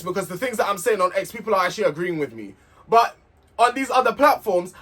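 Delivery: normal talking voice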